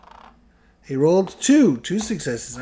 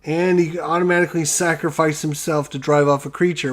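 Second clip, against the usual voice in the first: usual speaking voice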